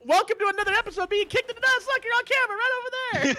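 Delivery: high, fast TV host voice; game show host. Pretend. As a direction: welcome viewers like a prank show host.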